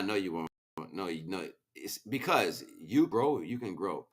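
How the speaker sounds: ordinary talk, nothing marked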